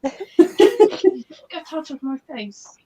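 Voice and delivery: laughing. Real, not acted. Laughing mock threat.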